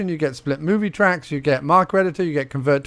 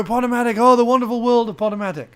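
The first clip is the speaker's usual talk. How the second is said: mock awe; 7 semitones higher, faster than usual. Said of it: "And you go".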